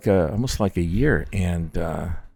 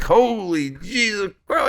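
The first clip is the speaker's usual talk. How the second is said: much higher, slow, stunned; shocked exclamation; 13 semitones higher, much slower than usual. Retold or imitated. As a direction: drag it out in disbelief. Shocked reaction to stepdad's complaint.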